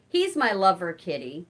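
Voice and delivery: normal talking voice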